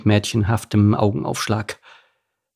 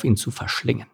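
ordinary delivery

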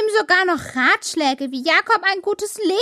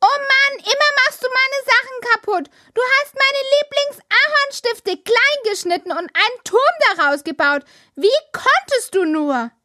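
empörtes Schimpfen; 7 semitones higher than usual. Acted. Schwester beschwert sich beim Bruder.

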